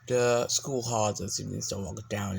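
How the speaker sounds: ordinary delivery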